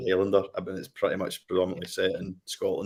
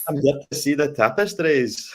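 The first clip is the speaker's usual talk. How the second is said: slow, high, loud mock Scottish accent; exaggerated comic Scottish imitation, loud and slow; Harrison Ford's joke Scottish accent; 7 semitones higher, 5 dB louder than usual. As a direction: draw out a hammed-up Scottish accent, pitched high. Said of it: "it's a joke accent".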